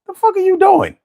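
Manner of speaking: high squeaky exasperated voice. Retold or imitated. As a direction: squeak it out in disbelief. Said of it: "With the squeaky voice".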